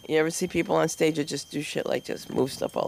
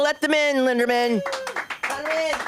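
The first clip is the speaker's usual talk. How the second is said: hype introduction; 10 semitones higher than usual. Real, not acted. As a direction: pump the crowd up for her. Host hypes the next comic.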